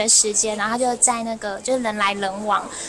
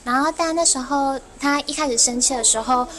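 normal talking voice

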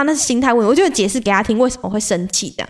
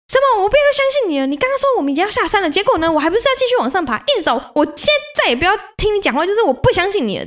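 high-pitched indignant outburst, fast in the middle; 10 semitones higher, faster than usual. Retold or imitated. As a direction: blow up at the hiking buddy, voice shooting up. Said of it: "一股脑突然爆气". (高八度、气冲冲地对山友发飙)

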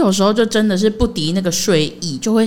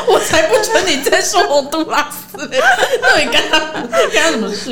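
laughing mock outburst; 8 semitones higher than usual. Mocking the girl's outburst.

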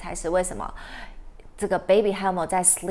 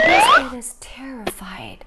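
breathy whisper full of fear; scared whisper; 2 semitones higher, 5 dB louder than usual. Acted. Teacher telling the class the puppy is terrified, in a scared whisper. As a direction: whisper it as if frightened.

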